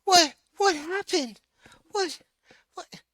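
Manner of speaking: very high, loud startled cries; panicked confusion. Acted. Aang seeing the boulder land on his ferrets, shocked and very high. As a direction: blurt it out in total shock. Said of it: "Aang's like".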